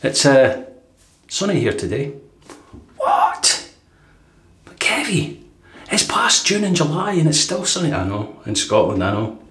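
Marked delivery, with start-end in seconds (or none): 3.0-3.7 very high, comic "What?" from a listener; a viewer's high, stunned What?; squeaky surprised viewer. Pretend. Voicing an imagined viewer's reply. 4.6-7.9 high, disbelieving voice for a viewer; raised voice of a doubting viewer; incredulous viewer. Pretend. Voicing an imagined viewer's reply.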